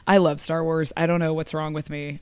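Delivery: ordinary delivery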